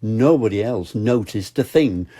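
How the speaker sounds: usual speaking voice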